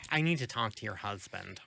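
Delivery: lightly mocking Karen demand; a hint of entitled complainer. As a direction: put on a bit of a demanding Karen. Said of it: "Karen manager".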